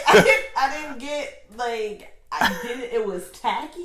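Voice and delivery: loud incredulous rant about a tacky look; excited. Real, not acted. Excited disagreement over runway look.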